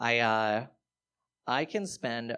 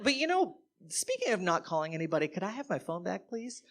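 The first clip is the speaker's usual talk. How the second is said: timid request; 6 semitones higher than usual. Retold or imitated. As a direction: meekly push your luck. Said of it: "I was like".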